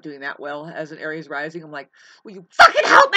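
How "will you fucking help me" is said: high, full-force furious scream; desperate scream. Acted. Desperate acted plea for help.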